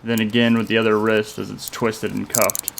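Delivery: ordinary delivery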